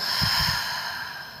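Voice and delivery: long sighing breath out; audible exhale. Shown as a demonstration. Guide demonstrating the exhale for listeners, audible sighing exhale. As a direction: let the breath go in one long sigh. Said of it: "and exhale".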